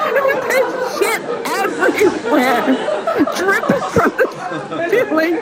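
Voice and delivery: high, giggling narration; laughing through the words. Real, not acted. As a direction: barely hold it together.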